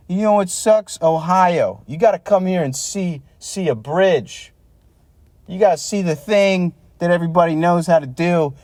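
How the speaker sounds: high, sarcastic boastful voice; mock Pittsburgh bragger. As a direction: parody a proud local.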